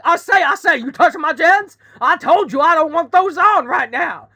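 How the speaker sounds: loud, high Southern chicken; high Southern accent, hammed-up scolding; Foghorn Leghorn. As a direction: berate them like a blustering cartoon rooster. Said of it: "chicken with a high southern accent".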